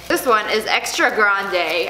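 usual speaking voice

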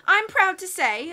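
proud announcement, slow, high and loud; high, loud, self-satisfied proclamation. A narrator's reading. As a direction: declare it grandly, drawn out with pride. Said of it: "Officer Luciana said proudly".

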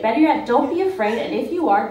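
ordinary delivery

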